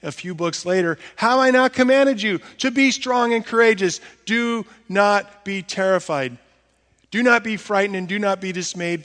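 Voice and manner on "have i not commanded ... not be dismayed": higher, louder proclaiming; forceful scripture. Acted. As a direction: call it out like a commander.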